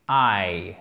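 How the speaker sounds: "eye" said low and separated off; a pause before "eye", said low; singular eye. Shown as a demonstration. Teacher stressing singular "eye" for French learners: low separate word. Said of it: "Eye, pas my eyes".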